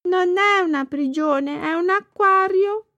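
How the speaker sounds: put-on boy Thomas. A narrator's reading. Thomas defending himself to the fish. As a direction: protest like a child.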